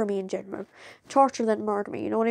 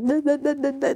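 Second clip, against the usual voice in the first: higher playground "nah, nah" teasing; teasing taunt; 5 semitones higher than usual. Retold or imitated. Rainbow Dash flying past Twilight, teasing taunt. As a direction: imitate a cartoon pony's cheeky taunt. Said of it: "tongue going out, like".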